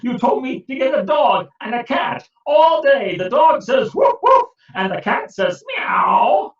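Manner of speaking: angry complaint. Acted. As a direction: vent your fury at the wise man. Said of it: "Peter was very angry".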